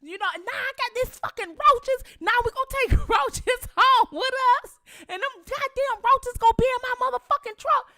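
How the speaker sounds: high mock cussing rant; cussing out family over roaches. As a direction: imitate herself cussing out the family. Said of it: "I was like".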